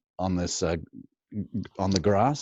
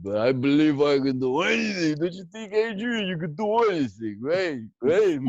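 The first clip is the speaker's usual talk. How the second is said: higher Rocky voice pleading to Adrian; Rocky impression; 7 semitones higher than usual. Rocky asking Adrian, higher and hopeful. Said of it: "when I saw Rocky".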